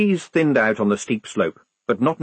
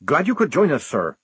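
forced politeness, pitched up at first; strained mock-friendly welcome; hissed affability; 6 semitones higher than usual. A narrator's reading. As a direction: greet an officer through suppressed annoyance. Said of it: "hissed the sergeant".

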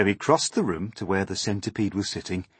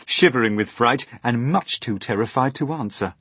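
ordinary delivery

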